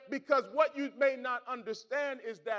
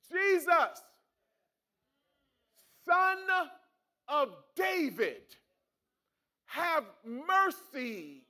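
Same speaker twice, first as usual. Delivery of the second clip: calling out; 4 semitones higher, slower than usual. Acted. Blind beggar crying out.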